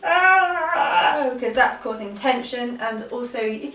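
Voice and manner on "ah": a big, strained yawn sound, high and loud; forced yawn. Shown as a demonstration. Teacher shows students how not to yawn: big strained yawn. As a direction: show the wrong, tense yawn. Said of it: "Don't force the yawn and be like".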